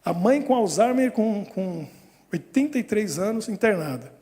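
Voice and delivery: ordinary delivery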